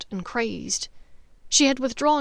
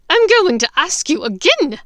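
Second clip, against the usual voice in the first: higher, louder, seething threat; 7 semitones higher, 10 dB louder than usual. Acted. Bellatrix seething at Hermione, loud and high. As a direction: barely hold back the rage. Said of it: "Bellatrix seethed".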